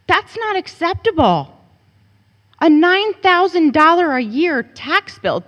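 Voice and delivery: furious parent complaining about the tax bill; angry outburst. Real, not acted. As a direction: let the anger break out. Angry parent at board meeting.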